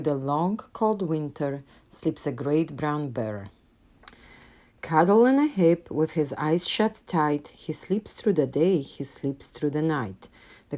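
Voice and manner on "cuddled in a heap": ordinary delivery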